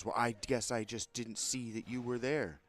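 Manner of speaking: loud, high confrontational retort; threatening comeback. Retold or imitated. Line-cutter stepping up in customer's face, threatening comeback. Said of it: "step up in my face all threatening".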